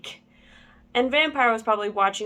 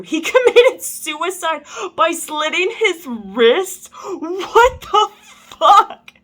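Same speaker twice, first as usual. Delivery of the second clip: high, loud disbelief breaking into laughter; incredulous outburst; 9 semitones higher, 8 dB louder, slower than usual. Real, not acted. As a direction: read it in baffled, laughing disbelief. Incredulous at the story's absurd turn.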